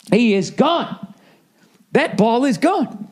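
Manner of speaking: "gone" stretched slowly with rising pitch; slow, high stretch on "gone"; drawn-out emphasis. Acted. Hammed-up emphasis on driver's disappearance.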